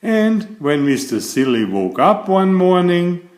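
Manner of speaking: plain reading voice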